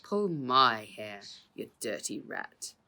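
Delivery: slow, loud defiant taunt; defiant jeer. Acted. Bald uncle jeering at the crook, defiant. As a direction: spit out the insult slowly.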